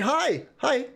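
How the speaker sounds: high, eager little his; excited hi. Real, not acted. Streamer greeting a Japan viewer, excited and high. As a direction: pop up into a cheery greeting.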